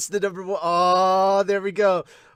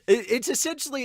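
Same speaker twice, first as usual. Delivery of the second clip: ordinary talk, nothing marked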